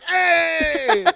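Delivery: high, loud mock cheer. Crowd cheering for Mama, mock loud cheer. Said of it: "everyone's like".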